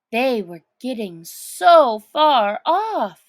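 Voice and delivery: ordinary delivery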